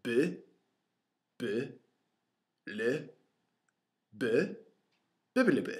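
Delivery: loud, high-pitched silly babble, syllable by syllable; ridiculous voice. Retold or imitated. Friend mocking a product label word by word, ridiculous voice. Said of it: "like, this ridiculous voice".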